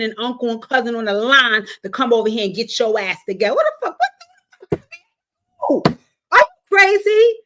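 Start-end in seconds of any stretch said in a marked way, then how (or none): 3.5-7.3 high squealed disbelief; high, loud outraged squeal; shocked outrage. Real, not acted. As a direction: shriek it in disbelief.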